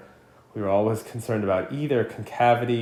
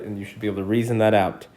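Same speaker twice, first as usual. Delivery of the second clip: ordinary delivery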